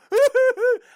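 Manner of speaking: a big derisive laugh in the middle of the sentence; loud mocking laugh. Real, not acted. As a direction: burst of loud scornful laughter. Mocking laugh at Juan Soto.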